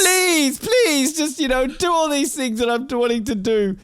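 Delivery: high, half-laughing plea; mock pleading. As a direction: keep begging, then trail off. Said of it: "he's just begging everyone".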